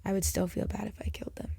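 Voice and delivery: soft breathy whisper, hurried. Real, not acted. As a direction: hush it like a confession. Confessing softly.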